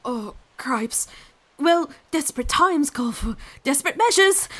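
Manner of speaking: put-on eager boy voice. Boy deciding to dunk her.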